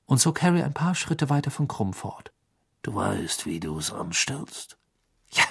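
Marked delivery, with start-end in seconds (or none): 2.8-4.8 low, soft, slow conspiratorial murmur; whispering. A narrator's reading. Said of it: "wisperte Bagman". 5.3-5.4 short breath-spent reply; panted, out of breath. A narrator's reading. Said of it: "keuchte Harry".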